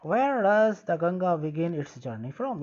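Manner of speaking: slow, careful read-aloud of English text; slow textbook reading. Shown as a demonstration. Teacher reading the textbook line slowly to pupils. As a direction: read each word out slowly for the class.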